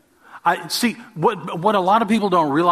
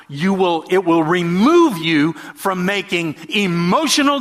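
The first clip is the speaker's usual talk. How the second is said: preaching fervor; 5 semitones higher, 4 dB louder than usual. Acted. Preaching climax.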